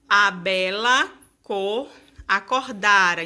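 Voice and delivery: slow scanning of a verse; poem recital. Shown as a demonstration. Teacher reading an example verse slowly for students. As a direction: read the line slowly, syllable by syllable. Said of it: "a gente tem aqui o exemplo".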